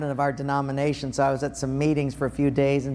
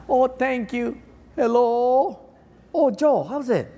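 cheery phone voice; 7 semitones higher, slower than usual. Retold or imitated.

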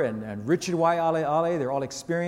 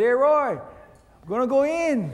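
raised and louder, then drawn out slowly; calling out; 7 semitones higher, 4 dB louder, slower than usual. Retold or imitated. Pastor calls to Roy that he is giving up, raised and louder. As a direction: call across the surf to Roy.

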